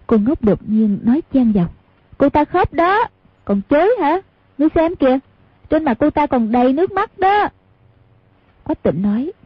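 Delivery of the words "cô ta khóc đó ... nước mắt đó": put-on much higher, childish tattling; naive simple girl. Cô ngốc telling Quách Tĩnh that Hoàng Dung cried, childish.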